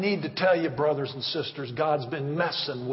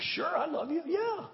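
a lightly voiced, higher, offhand yes; breezy self-imitation; 12 semitones higher, 4 dB quieter than usual. Pastor imitating his own casual answer to God, higher. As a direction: toss it off casually. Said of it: "I said".

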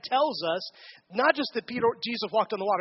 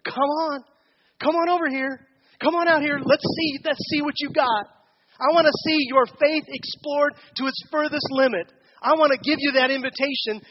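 louder, higher inviting call; God calling; 3 semitones higher, 6 dB louder than usual. Acted. God coaxing a believer, a lightly voiced eager call. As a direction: call them out onto the water, coaxing. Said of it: "God is saying to us".

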